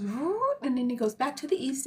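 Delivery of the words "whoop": rising high whoop of the sun jumping a portal; a quick playful whoop. Retold or imitated. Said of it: "and he goes".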